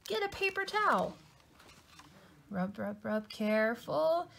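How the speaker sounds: high, frantic and alarmed; scrambling to save the page; panicked. Acted. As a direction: Louie panics trying to clean the page. Louie panics over stain.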